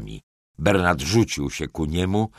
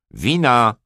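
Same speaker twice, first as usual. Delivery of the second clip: loud, high demand; żądanie; 8 semitones higher, 8 dB louder than usual. A narrator's reading. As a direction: call out imperiously for service. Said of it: "zażądał pan Nawarkles". (głośne, władcze żądanie)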